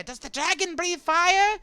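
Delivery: high, loud curious cry; excited creature shouting. Acted. Small Alliamite asking about the dragon, high excited shout. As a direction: call the question out in a squeaky little voice. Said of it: "They, uh, shouting out".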